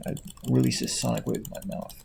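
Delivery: unvoiced, hushed joke boast; whispered mock line. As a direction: breathe it like a silly secret power.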